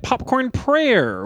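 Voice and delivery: slow, drawn-out segment title; announcer. Acted. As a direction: announce it grandly and slowly.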